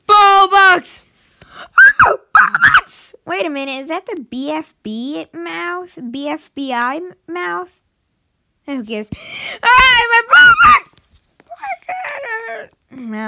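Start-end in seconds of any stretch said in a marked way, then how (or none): 0.1-3.1 very high, loud squeal of delight; excited squeal. Real, not acted. Player spots Robux after a gasp, squealing high and loud. 9.6-13.3 high, loud cry of loss; anguished cry. Real, not acted. Gamer crying out at his lost Robux, high and loud.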